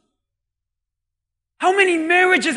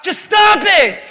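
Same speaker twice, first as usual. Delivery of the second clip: high, loud demonstration for the crowd; shouted command; 4 semitones higher, 5 dB louder, slower than usual. Shown as a demonstration. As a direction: shout it for the congregation to repeat.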